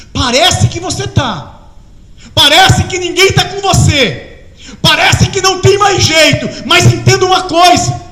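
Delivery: rising to loud, impassioned preaching; fervent preaching. Acted. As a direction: build from slow comfort to a loud cry of assurance. Preaching builds to full force.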